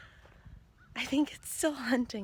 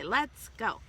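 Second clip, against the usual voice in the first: prompt; 7 dB louder than usual. Shown as a demonstration.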